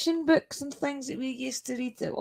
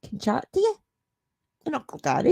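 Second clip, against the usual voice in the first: usual speaking voice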